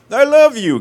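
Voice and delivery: high, loud mock love declaration; hammed-up movie line. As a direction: belt out an actor's big line. Acts out a film take.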